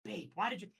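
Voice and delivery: small, halting, submissive imitation; meek mimicry. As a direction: imitate a man feebly protesting under his breath. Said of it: "He just kinda took it".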